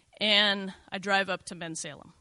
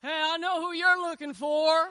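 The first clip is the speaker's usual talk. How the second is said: high, loud call from a truck; retold yell; 10 semitones higher, 6 dB louder than usual. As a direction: shout it across the cemetery. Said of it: "he yells out of his truck".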